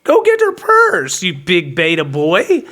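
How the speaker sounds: high, goading command; taunting order. Retold or imitated. Man ordering woman's date to fetch purse, taunting.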